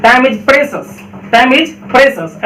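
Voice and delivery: precious said "pre-shus", slowly; correct pronunciation. Shown as a demonstration. Teacher modelling the right English phrase, slowly. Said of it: "आपको क्या बोलना चाहिए था?".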